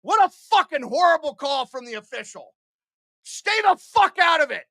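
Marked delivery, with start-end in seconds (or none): 3.2-4.6 high, loud yell at the refs; angry shout. Real, not acted. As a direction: roar it at the officials in fury. Streamer yelling at the officials.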